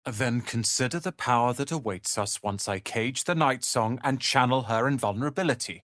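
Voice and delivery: ordinary talk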